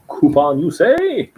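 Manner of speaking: high, rising comic query; mock intrigue. Podcast guest teasing the host about coupons, mock intrigue. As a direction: play up intrigue, sliding way up at the end.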